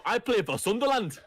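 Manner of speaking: put-on high, laughing Geordie accent. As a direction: Jordan Henderson in a Geordie accent. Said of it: "in his Geordie accent come in and go".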